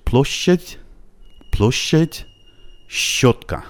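long tense sh in "پلوشت" and "شوتکا"; doubled soft sh. Shown as a demonstration. Teacher repeating both models for learners, doubled soft sh.

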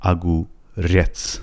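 slow اگوریتس, stress held on the final ریتس; stress on last syllable. Shown as a demonstration. Teacher showing where stress falls, slow اگوریتس stressed at the end. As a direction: break اگوریتس up, leaning on ریتس. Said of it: "فشارش روی رتس هستش".

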